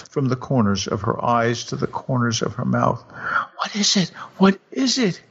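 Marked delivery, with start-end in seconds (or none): 3.6-4.1 higher husband voice asking gently; a worried husband, first ask; husband's voice. Acted. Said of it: "he begged soothingly". 4.4-5.2 even higher, a bit louder, pleading; a worried husband begging the second time; husband's voice. Acted. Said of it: "he begged soothingly".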